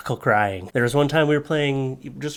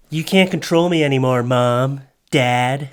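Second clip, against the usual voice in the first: loud, hammed-up teen defiance; playful mock tantrum; mock rebellious yell; 2 semitones higher, 6 dB louder than usual. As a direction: shout back at the parents like a rebellious kid. Mock defiance at imagined parents.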